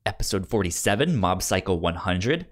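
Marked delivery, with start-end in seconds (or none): none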